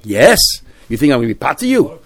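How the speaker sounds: "Yes" swung up as an incredulous question; "potter you" flung out as a challenge; question mark tone. Acted. Injured man rejects the idea, question mark tone. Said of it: "said in the question mark".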